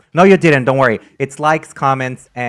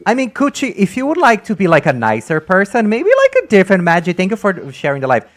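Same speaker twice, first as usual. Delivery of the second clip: high playful voice; 7 semitones higher, 4 dB louder than usual. Real, not acted. High pitched playful advice.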